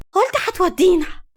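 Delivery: put-on girl angrily reproaches her father about the amusement park; angry child. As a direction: protest like a cheated child, stressing اصلًا. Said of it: "حبيبة بغضب".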